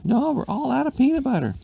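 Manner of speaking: very high squeaky voice; fed-up lady. A narrator's reading. Wife telling husband they have no peanut butter, high squeak.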